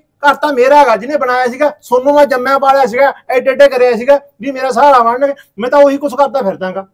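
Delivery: hurt father raising his voice at his son; bitter complaint. Acted. Father complains about split family.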